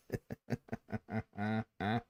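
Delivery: soft nervous laugh; nervous laughter. Real, not acted. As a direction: chuckle nervously under your breath. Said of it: "I don't know why I'm laughing".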